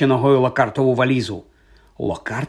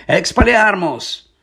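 higher, drawn-out incantation; shouted spell; 6 semitones higher, slower than usual. A narrator's reading. Boy disarming his teacher with a spell, crying out. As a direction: cry out the spell. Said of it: "Гаррі закричав".

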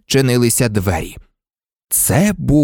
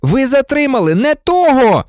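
put-on high, frantic boy's outcry; desperate shouted correction; yelling; 13 semitones higher, 4 dB louder than usual. A narrator's reading. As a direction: protest loudly at a high pitch. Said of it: "зарепетував Гаррі".

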